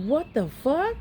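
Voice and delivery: high, rising incredulous question; shocked disbelief. Retold or imitated. Mother seeing her son's eyes cloud over, shocked disbelief. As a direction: re-enact shock with the pitch leaping up.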